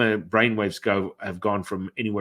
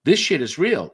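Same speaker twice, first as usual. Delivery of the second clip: high, loud alarmed whoas; freaked-out client; 8 semitones higher, 5 dB louder than usual. Retold or imitated. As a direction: beg him to stop, rattled. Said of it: "they're like".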